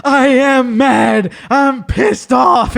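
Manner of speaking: high, loud, slow angry shout; mock furious. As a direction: imitate a hammed-up tantrum. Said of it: "I'm sensing you're a little mad".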